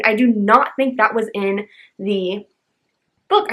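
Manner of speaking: ordinary talk, nothing marked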